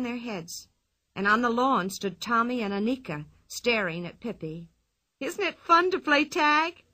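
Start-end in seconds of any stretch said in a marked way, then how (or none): 5.2-6.8 higher, louder, slow and gleeful; cried out. Acted. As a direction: call down happily from the roof. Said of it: "cried Pippi".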